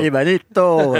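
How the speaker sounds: put-on light Spanish-accented nickname, b like a v. Teasing a friend with a mild Spanish accent. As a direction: echo the name the Spanish way. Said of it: "cet accent espagnol".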